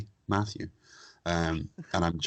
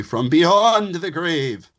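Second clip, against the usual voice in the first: loud, raised, hammed-up spook voice; mock ghostly; 10 semitones higher, 9 dB louder, much slower than usual. Host announcing the podcast from the dead, mock ghostly. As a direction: wail it like a pretend ghost.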